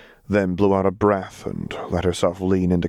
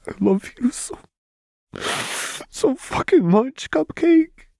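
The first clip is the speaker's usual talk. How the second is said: high, tearful voice breaking into squeaks; sobbing; 3 dB louder than usual. A narrator's reading. Vi to her lover as she is held, sobbing. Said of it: "Vi sobbed".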